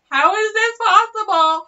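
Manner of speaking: high mock disbelief; mock Nancy Pelosi shock. Politician shocked by the chaos, mock high disbelief. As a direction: play stunned, pitch jumped up. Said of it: "she's just like".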